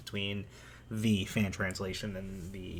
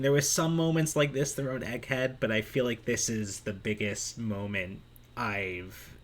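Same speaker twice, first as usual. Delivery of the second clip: ordinary talk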